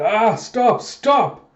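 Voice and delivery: high, loud cries, climbing in pitch; panicked shouting. Retold or imitated. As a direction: yell for it to stop in alarm. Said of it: "Joskin's calling it".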